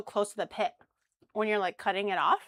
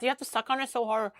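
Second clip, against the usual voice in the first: ordinary delivery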